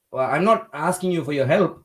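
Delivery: usual speaking voice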